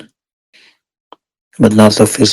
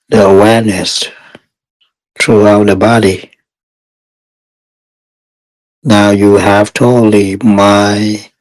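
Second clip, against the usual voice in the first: guided meditation. Real, not acted. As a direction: guide meditators step by step, unhurried and even.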